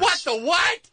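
high, incredulous outburst; astonished. Real, not acted. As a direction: blurt it out in disbelief.

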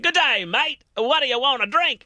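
high, eager fake Australian greeting; excited Aussie waiter. Imagined waiter greeting diners at Outback, excited Australian accent. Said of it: "like, all excited or whatever".

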